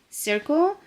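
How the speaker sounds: ordinary delivery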